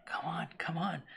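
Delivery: breathless coaxing whisper. Retold or imitated. Sewer dweller coaxing the cameraman forward, whispering. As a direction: coax it softly under your breath.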